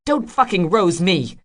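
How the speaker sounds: loud, angry snap; snapped. A narrator's reading. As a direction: bite it off in anger. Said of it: "she snapped".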